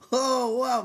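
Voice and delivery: very high, very loud acted amazement; hammed-up wowed outburst; amazed. Teller reacts to Max's new look, amazed.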